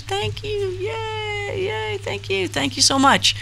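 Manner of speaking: very high, tiny squeal of thanks; delighted thanks. Real, not acted. Thanking a viewer for a five-dollar donation, delighted. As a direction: squeak out a small, soft cheer.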